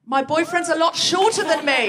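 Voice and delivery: repeating for the room. Real, not acted. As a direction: announce her answer to the crowd. Host repeats into microphone.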